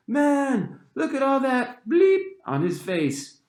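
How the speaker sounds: high, slow, mocking schoolkid voice; jeering kid. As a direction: taunt him in front of everyone. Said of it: "I heard different versions of".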